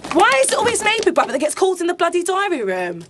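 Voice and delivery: ordinary delivery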